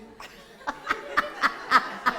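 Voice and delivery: breathy high-pitched laugh. Real, not acted. Storyteller laughing at his own panic, high and breathless.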